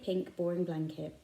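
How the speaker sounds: usual speaking voice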